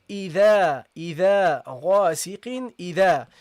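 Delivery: إذا and غاسق, alif light then heavy; teacher drills idha and ghasiq aloud; reciting the words. Shown as a demonstration. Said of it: "So you go, you go here".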